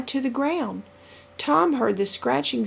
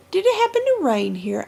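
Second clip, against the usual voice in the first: eager question; 8 semitones higher, 5 dB louder than usual. A narrator's reading. Tom asks eagerly.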